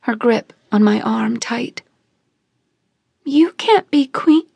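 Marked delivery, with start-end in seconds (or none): 3.2-4.5 through tears. A narrator's reading. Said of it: "mumbled through tears".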